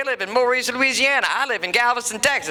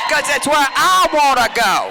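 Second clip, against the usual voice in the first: shout; 3 semitones higher, 6 dB louder than usual. Real, not acted. Punchline shouted to the audience.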